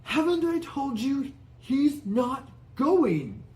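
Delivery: high, slow furious hiss. Acted. Uncle Vernon hissing at Hagrid, high slow fury. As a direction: spit the words at him. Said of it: "he hissed".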